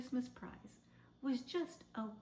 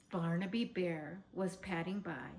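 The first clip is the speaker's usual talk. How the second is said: ordinary delivery, nothing marked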